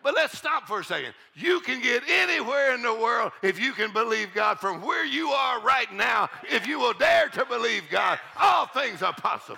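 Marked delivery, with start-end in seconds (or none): 1.3-9.6 preaching shout. Real, not acted. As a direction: build it up to a shout.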